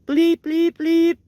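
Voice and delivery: high, loud mock bleeps; censor bleep. Censoring his own swearing.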